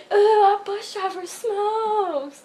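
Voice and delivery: slow, high, teasing reveal; sing-song gotcha. Retold or imitated. Girl catching bus driver's cigarettes, slow teasing gotcha. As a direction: drag it out to get him in trouble.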